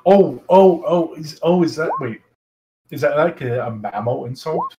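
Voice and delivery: ordinary delivery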